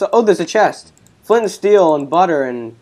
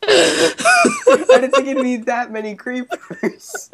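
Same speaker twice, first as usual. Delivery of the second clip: high, slow words through laughter; helpless with laughter; laughing hard; 8 semitones higher, much slower than usual. Real, not acted. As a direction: squeal it out while laughing. Cracking up after an explosion.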